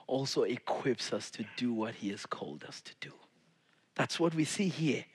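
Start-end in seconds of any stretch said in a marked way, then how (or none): 0.1-3.1 breathy unvoiced whisper to the congregation. Real, not acted. Preacher whispering God's promise to the listeners. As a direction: lean in and whisper it.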